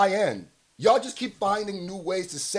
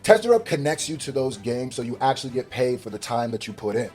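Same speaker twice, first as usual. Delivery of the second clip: upbeat sponsor pitch, louder and punchy; ad read; 8 dB louder than usual. Acted. Streamer pitching app to his viewers, upbeat ad read. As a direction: sell it to the viewers like a hype ad.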